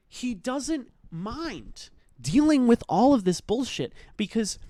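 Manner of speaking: high-pitched, exasperated outrage; indignant rant. Real, not acted. Reviewer condemning Bear's coward way out, indignant rant. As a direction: build from high disbelief to loud indignation.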